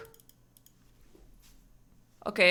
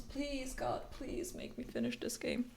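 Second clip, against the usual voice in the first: whispered plea; 5 semitones higher, 9 dB quieter than usual. Real, not acted. Begging game to end.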